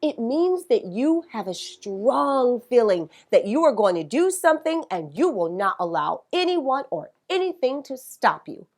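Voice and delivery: usual speaking voice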